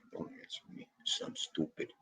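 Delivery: whispered aside. Real, not acted. Self-mocking aside to the chat, whispered. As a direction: whisper it to yourself.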